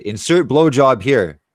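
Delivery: loud, drawn-out joke title; mock title announcement. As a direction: boom it out like a video title card. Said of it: "that's what I'll title the video".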